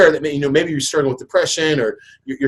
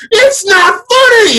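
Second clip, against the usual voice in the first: much higher, slow, loud half-crying protest; upset wife; 7 dB louder, much slower than usual. Retold or imitated. Wife scolding laughing husband, high and tearful.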